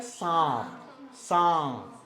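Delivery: सांप with a long nasalized vowel; सांप, drawn out and nasal. Shown as a demonstration. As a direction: send the vowel of सांप into the nose. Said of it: "क्या नाक पे आवाज़ जा रही है".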